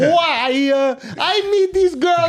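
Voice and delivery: put-on high, wailing Italian accent; drawn-out, high-pitched Italian complaint; hammed-up Italian accent. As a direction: plead like an exasperated cartoon Italian. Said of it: "I had to snap out of that one".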